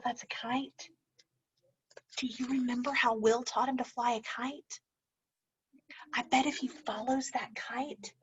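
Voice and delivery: soft, secretive whisper; whispering to the class about the kite; suspenseful whisper. Acted. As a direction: draw the kids in with a hush. Building suspense for the kids.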